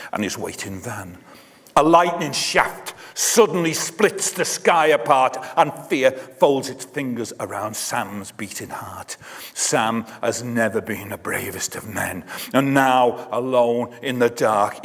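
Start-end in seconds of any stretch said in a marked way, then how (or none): none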